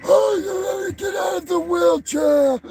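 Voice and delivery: high put-on voice rising into a yell; mock Arthur. Vlogger mocks Arthur in the interview, high hammed-up voice climbing to a shout. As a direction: ham up the fraud pleading in his interview. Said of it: "He's like".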